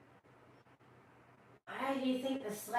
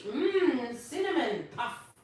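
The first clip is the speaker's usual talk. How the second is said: usual speaking voice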